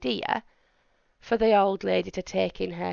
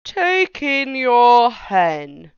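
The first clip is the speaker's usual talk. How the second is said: put-on slow, deliberate wise old man; measured advice from an old sage; 3 semitones higher, 7 dB louder, much slower than usual. Wise old man advising the lady, slow and deliberate.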